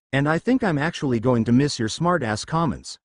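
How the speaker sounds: ordinary delivery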